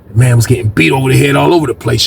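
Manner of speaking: ordinary delivery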